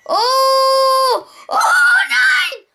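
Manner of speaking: rising cries up to a shrieked number; mounting, screaming anticipation; building excitement. Real, not acted. Score climbing toward goal.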